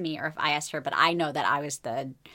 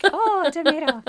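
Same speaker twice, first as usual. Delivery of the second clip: high mock delight; 11 semitones higher, 7 dB louder than usual. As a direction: gush over it like a treat. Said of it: "but it's not like".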